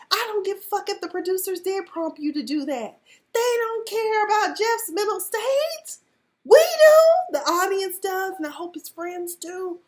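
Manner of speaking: high, loud, near-tearful outburst; heated rant defending Jeff; impassioned rant. Real, not acted. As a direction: plead his case with rising passion.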